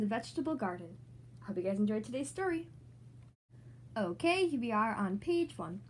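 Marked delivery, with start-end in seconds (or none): none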